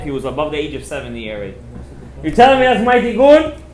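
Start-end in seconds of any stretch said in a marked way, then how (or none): 2.2-3.6 retold outrage, raised and loud; indignant outburst. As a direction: throw them out, acting offended. Upset rabbi rejects the advice.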